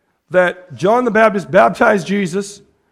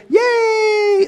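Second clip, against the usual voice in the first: high, drawn-out mock cheer; exaggerated party cheer, held high; 13 semitones higher than usual. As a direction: squeal a long celebratory yay. Mocks celebration of new converts.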